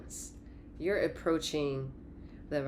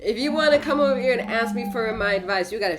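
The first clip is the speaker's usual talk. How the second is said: loud scolding; 6 semitones higher, 9 dB louder, faster than usual. Acted. Scolding advice-seekers, loud.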